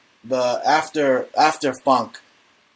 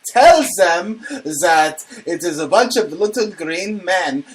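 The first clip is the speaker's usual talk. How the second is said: put-on high, drawn-out German accent; hammed-up German accent, words stretched; 4 semitones higher, slower than usual. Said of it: "do it in the German voice".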